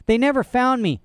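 doubtful. A narrator's reading. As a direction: protest uncertainly like a child. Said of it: "she said doubtfully".

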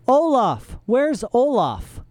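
high calling voices. A narrator's reading. Troupe members looking for Olaf, calling out. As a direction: call from the next room. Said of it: "voices called out".

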